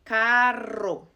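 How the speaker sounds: carro with a trilled rr; rolled rr. Shown as a demonstration. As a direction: roll the rr in carro. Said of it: "strong R sound".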